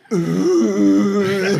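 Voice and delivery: loud, drawn-out mock ooh; high collective "ooh" from impressed inmates; impressed crowd ooh. Prisoners hearing counterfeit and forgery, impressed ooh. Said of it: "And they all went".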